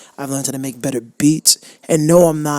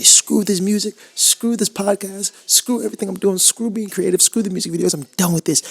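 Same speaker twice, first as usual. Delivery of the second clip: higher pitched, disgusted dismissal of everything; fed-up quitting; 6 semitones higher than usual. Pretend. Future self at thirty-four giving up on music, fed-up. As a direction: toss it all away in disgust.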